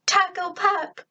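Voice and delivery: high, happy call; excited cry. A narrator's reading. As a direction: greet him with delight. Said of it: "I cried".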